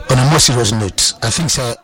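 usual speaking voice

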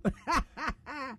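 bursts into a real laugh. Real, not acted. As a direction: laugh out at the joke. Laughs at listener's message.

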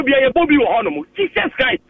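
ordinary talk, nothing marked

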